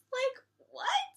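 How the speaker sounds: high incredulous what; can't believe the excuse; mocking the counselor's lame excuse, baffled. Real, not acted.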